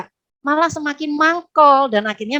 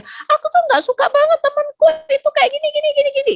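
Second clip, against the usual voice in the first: high-pitched, hammed-up grumbling tirade; ranting child; 3 dB louder, faster than usual. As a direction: imitate a child ranting wildly in a squeaky high voice. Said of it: "ngomel-ngomel nggak karu-karuan". (menirukan anak ngomel-ngomel bernada tinggi)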